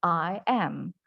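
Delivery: "I am" in crisp separate words, British style; British "I am", both words fully pronounced; British model of "I am", unlinked; British English. Shown as a demonstration. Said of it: "British English, they will say".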